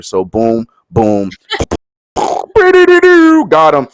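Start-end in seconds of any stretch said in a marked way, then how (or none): 2.2-3.4 high, loud burst of laughter; real laugh. Real, not acted. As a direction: crack up at the punchline. Laughing at his own joke.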